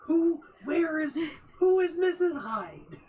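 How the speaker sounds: loud, high, indignant demand; outraged shout. Real, not acted. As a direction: shout the question in disbelief. Demanding answers about missing mother.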